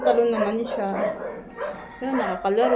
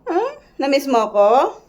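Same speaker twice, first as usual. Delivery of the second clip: ordinary talk, nothing marked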